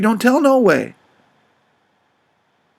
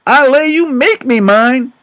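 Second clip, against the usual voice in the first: slow, louder and higher, seething; furious threat; 5 semitones higher, 5 dB louder, slower than usual. Retold or imitated. Jim threatening his little girl, slow furious warning. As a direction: lay down the threat word by word. Father threatens disobedient child.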